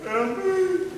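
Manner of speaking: very high, whiny help me; hammed-up high starving-artist plea; mock pleading. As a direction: beg like a broke artist on the street. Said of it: "walking around in Hollywood going".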